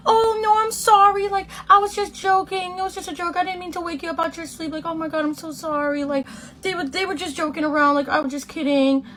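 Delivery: high sugary apology; high fake-sweet sorry; backpedaling apology. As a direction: play innocent, voice way up.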